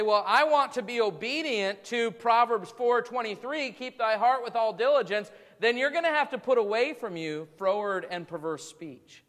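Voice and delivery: rising sermon fervor; full-force preaching. Acted. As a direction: drive the point home to the congregation.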